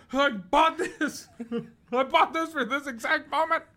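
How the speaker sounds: high, slow, laughing gleeful voice; excited gun owner. Pretend. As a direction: gloat over the shotgun, cackling. Imitating a dude with shotgun.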